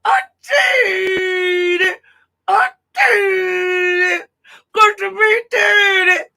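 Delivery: high, slow, drawn-out mock crying voice; hammed-up sobbing imitation, stretched out; mocking sobbing witness. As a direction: whimper it like a hammed-up weepy victim. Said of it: "she's talking like".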